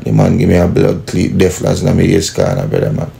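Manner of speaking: ordinary delivery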